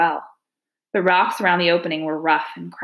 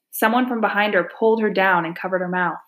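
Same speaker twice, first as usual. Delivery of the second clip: ordinary delivery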